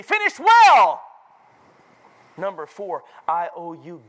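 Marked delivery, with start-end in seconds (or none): 0.0-1.0 high, loud, drawn-out climax; exultant preaching cry; triumphant shout. Real, not acted. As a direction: ring it out slowly from the pulpit.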